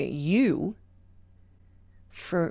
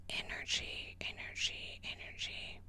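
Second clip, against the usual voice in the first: whispered, softer each time; fading whisper; 16 dB quieter than usual. Acted. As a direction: let energy drift away into breath. Repeated word fading away.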